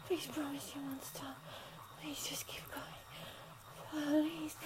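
breathless hushed whisper. Acted. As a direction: breathe the words softly.

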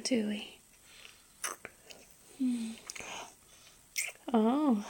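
normal talking voice

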